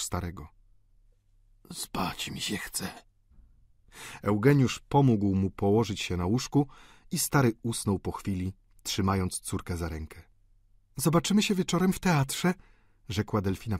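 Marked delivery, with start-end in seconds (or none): 1.7-2.9 put-on slow, soft, breath-only old man; exhausted, whispered drawl; sleepy whisper. A narrator's reading. 11.0-12.5 high female character voice; lightly pitched-up lady's farewell; society woman. A narrator's reading. Delphine takes leave of Eugène.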